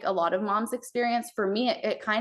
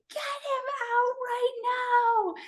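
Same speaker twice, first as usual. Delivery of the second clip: strained, much higher, slow urgent plea. Retold or imitated. Mother in labour begging to get baby out, high and strained. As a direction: push the words up high and urgent, as if mid-birth. Urgent thought during birth.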